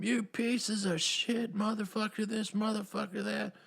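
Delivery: high, put-on voice cursing them out; mock villain berating her squad; mock Waller cursing out the team. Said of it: "she's calling them".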